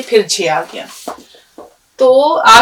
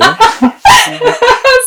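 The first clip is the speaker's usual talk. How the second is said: a short, high burst of laughter; real laugh; 3 dB louder than usual. Real, not acted. Teacher laughing at the student's funny answer, bright laugh. Student's joke about the mouse.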